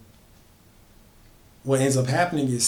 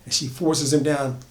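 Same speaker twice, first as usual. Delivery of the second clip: usual speaking voice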